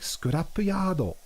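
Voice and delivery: put-on Scrapyard in a Japanese accent, vowels added between consonants; imitated Japanese accent. Imitates Japanese pronunciation of name.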